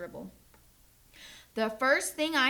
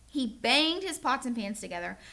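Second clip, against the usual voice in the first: ordinary delivery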